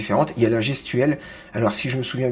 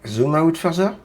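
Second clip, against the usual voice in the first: higher, rising American-accented try; questioning American accent; 4 semitones higher than usual. Shown as a demonstration. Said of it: "l'accent américain".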